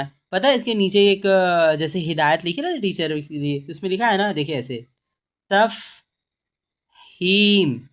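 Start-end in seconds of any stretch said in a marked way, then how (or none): 5.5-7.8 very slow sounding-out. Shown as a demonstration. Teacher showing what is written, very slow sounding-out. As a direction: stretch तफहीम into taf... heem with a long gap. Teacher spells out word while writing.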